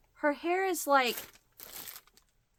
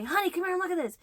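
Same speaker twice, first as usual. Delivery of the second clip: imitating husband; 10 semitones higher, much faster than usual. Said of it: "the antique mall and say".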